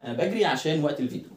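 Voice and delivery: normal talking voice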